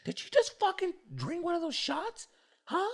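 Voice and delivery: very high mock-girl falsetto; imitated angry girl. Girl confronting the shot thief, mock high-pitched indignation. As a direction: squeal it as an offended girl. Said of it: "she's like".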